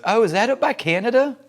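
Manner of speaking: a hint of a chirpy teen cashier; young shop girl. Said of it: "And she goes".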